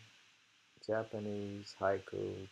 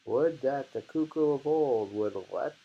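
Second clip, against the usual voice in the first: loud, slow, declaimed haiku; dramatic poem reading; 6 dB louder, slower than usual. Acted. Reading the cuckoo haiku aloud, loud and slow.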